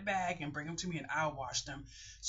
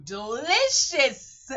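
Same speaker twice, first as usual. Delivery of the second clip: drawn-out, louder, higher delicious; savoring delight; 6 semitones higher, 9 dB louder, much slower than usual. Real, not acted. Praising her homemade soup, slow relishing delight. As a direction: stretch delicious out slowly, relishing it.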